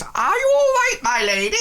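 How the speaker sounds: very high, loud fussing cook; cook's voice. Acted. Cook fussing over the lady in a high squeak. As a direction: squeak it out like a flustered servant. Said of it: "she sort of goes".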